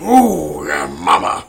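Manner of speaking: loud leering ooh yeah mama; lecherous drunk. Retold or imitated. Actor boasting about his new wife: loud leering growl. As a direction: ham up a randy drunk actor. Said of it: "he's like".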